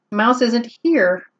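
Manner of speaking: plain reading voice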